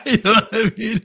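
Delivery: high, loud words through laughter; real laughter running through the words; laughing. Real, not acted. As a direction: laugh while checking they got the joke. Laughing at own joke.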